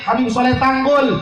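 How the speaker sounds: normal talking voice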